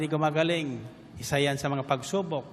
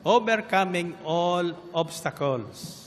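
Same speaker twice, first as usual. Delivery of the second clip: slow solemn definition; slower than usual. Acted. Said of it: "preacher defines the virtue slowly".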